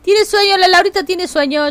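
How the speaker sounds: aguda y más fuerte, tono de nena mimosa; hablá de vos misma como una nena con sueño; voz aniñada. Pretend. Repite lo del chat en tercera persona. (voz de nena con sueño, agudo)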